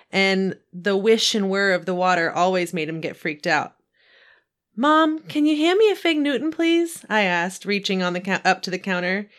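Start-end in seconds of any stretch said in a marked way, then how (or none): 4.8-7.0 put-on boy asking.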